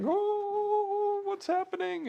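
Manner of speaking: high startled cry of alarm; recalling sudden panic as the ice gives way; retold alarm. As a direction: squeal it like the car's going down. Retold panic as car sinks.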